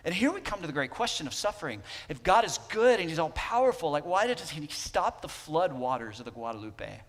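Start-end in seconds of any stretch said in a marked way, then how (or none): none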